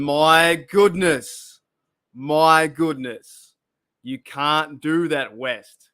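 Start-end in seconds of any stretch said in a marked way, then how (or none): 0.0-3.5 drawn-out disbelief. Real, not acted. Stunned by a chat comment.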